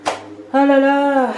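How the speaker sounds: loud drawn-out groan of dismay; exasperated. Real, not acted. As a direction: draw out a real exasperated lament. Exasperated after a botched repair.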